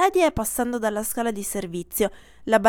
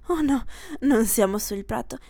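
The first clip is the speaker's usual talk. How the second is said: usual speaking voice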